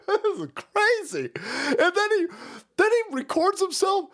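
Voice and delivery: high laughing disbelief; incredulous laughing. Real, not acted.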